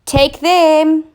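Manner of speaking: high, loud brush-off; queen ordering the king to take the socks; curt shout. Acted. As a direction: snap it short and final. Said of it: "she shouted back".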